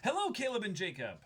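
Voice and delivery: usual speaking voice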